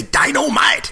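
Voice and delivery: high, punchy Dynamite!; catchphrase. Retold or imitated. Jimmy Walker's catchphrase in Good Times, high exclaimed. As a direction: belt it out like a seventies sitcom catchphrase. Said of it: "he used to say".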